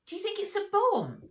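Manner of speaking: put-on high, loud frightened boy voice; scared boy. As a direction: blurt it out in fright.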